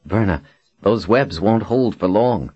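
ordinary delivery

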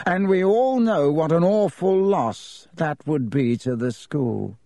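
high-pitched, silky villain voice; smug Malfoy, lazily insincere regret. A narrator's reading.

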